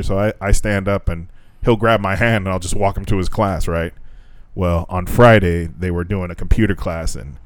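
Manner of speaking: ordinary delivery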